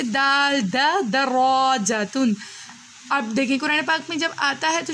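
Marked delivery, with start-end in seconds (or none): 0.0-2.3 دا then دراجتن, read slowly; slow sounding out. Shown as a demonstration. Teacher reads the word to the child, slowly. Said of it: "اب ہم اس کو کیسے پڑھیں گے".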